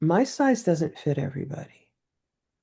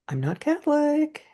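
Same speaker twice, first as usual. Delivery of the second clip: a mock panicked squeak of protest; alarmed protest; 10 semitones higher than usual. Dragged to communion, she protests in a high alarmed voice. Protesting as friend drags her up.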